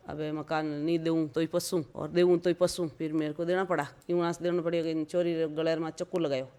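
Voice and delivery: ordinary delivery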